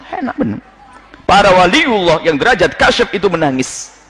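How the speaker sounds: usual speaking voice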